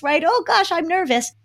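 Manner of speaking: high, fast jittery self-talk that settles; nervous but coping. Shown as a demonstration. Parent modeling nerves to kids, jittery then calm. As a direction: flutter with nerves, then steady yourself. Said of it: "You say to your kids".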